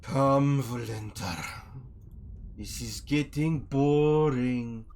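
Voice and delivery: loud, slow impatient drawl; bored henchman. Acted. Man at the back rolls his eyes at her, loud and bored. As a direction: call her away, drawing out the boredom. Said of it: "rolls his eyes and says".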